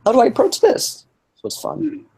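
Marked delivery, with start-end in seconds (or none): 0.0-1.0 dynamic character voice. Retold or imitated. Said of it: "So each time it's like".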